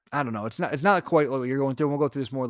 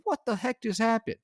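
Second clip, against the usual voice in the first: high-pitched retold disbelief; alarmed bewilderment; 9 semitones higher than usual. Dad after baby coughs up phlegm, bewildered. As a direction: exclaim it high, baffled and concerned. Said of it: "all of a sudden concerned".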